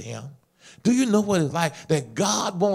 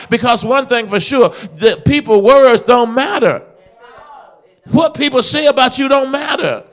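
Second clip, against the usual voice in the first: high, loud sermon delivery; full-voiced preacher at peak force; impassioned preaching; 8 semitones higher, 8 dB louder than usual. Real, not acted. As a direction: build to a ringing, defiant shout.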